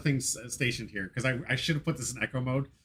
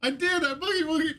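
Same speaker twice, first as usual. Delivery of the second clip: mock opponent. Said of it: "you were like".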